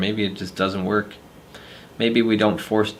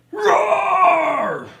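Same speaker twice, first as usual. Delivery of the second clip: screamed roar, much higher and louder; monster roar; 5 dB louder than usual. Acted. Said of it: "We're getting there".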